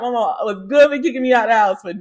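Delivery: normal talking voice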